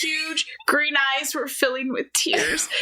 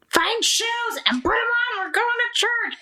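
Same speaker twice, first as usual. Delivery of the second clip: much higher, slow; mock mom order; stern parent; 11 semitones higher than usual. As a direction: bark it at the kid.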